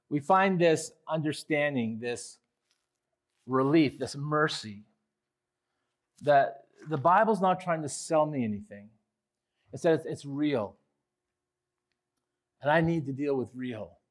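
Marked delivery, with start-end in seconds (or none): none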